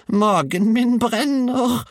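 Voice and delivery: very high, slow wail; panicked shout. A narrator's reading. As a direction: wail it out as the burning grandmother. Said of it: "ropte hun plutselig".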